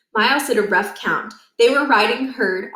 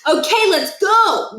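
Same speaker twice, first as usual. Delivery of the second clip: high, loud, slow rallying call; a cheery call to get going; rallying shout; 10 semitones higher, 5 dB louder, slower than usual. A narrator's reading. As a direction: get the herd moving. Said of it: "Niles clapped his hands".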